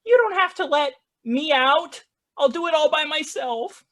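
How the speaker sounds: very high little kitten voice. Acted. Kittens answer the bartender, high-pitched kitten voice. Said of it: "The kittens, they take it very well".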